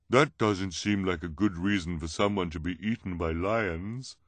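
careful objection in a put-on voice; character voice, guarded and measured; disguised voice. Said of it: "in her disguised voice".

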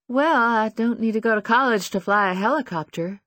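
put-on hesitant, unsure young woman; uncertain. A narrator's reading. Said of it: "Lucy says with uncertainty".

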